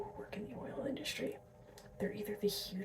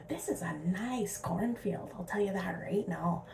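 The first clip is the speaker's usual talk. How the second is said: imitating Alberta accent; 7 dB louder than usual. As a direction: mimic a small-town Alberta farmer in a whisper. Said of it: "people that talk like that".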